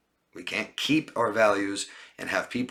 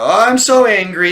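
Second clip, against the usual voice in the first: much higher, much louder, hammed-up fake fury; mock fake anger; 11 semitones higher, 14 dB louder than usual. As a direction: mimic a badly faked outburst, overdone on purpose. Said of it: "fake these kind of emotional, angry outbursts".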